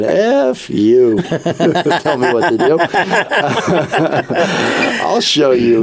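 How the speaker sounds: defiant grumpy listener, laughing through it; mock angry listener. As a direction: play the irate caller, half joking. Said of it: "swearing at the radio going".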